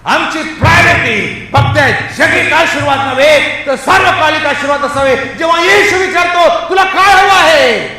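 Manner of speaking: high, loud shouted sermon; full-voiced exhortation; forceful preaching. Real, not acted. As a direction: cry it out to the congregation.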